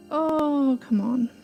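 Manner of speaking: ordinary talk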